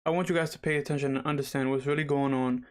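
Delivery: normal talking voice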